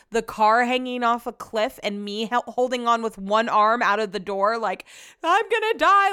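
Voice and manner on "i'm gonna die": high mock panicked cry. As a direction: play it as a fake scream for life. Imagined panic hanging off cliff.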